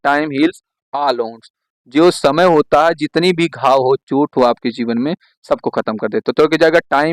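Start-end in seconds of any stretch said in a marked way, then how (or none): 0.1-1.5 each word drawn out; very slow dictation. Shown as a demonstration. Teacher gives the idiom for students to write, very slowly. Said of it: "क्या लगाओगे आप लोग?".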